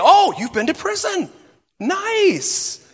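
high, sneering mock praise; sarcastic. Church folk mocking a former prisoner, high sarcastic sneer. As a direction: sneer at the ex-con with fake approval.

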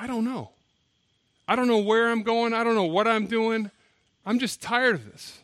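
mock-tearful, worn-out lament; retold bawling. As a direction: act out a man breaking down, without real sobs. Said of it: "he just starts bawling".

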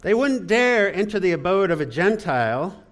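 high, loud English stage delivery; hammed-up English accent, mock indignation; mock English thespian. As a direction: declaim it like an old English thespian. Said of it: "I think it's the old thespian".

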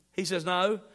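ordinary talk, nothing marked

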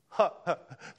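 a quick real laugh that falls away; amused burst of laughter; short laugh. Real, not acted. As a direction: laugh before catching yourself. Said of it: "Sorry, I shouldn't have laughed at that".

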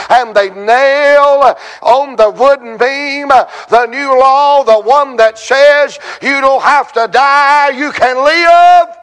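driving revival cadence, phrase upon phrase; rhythmic preaching. Acted.

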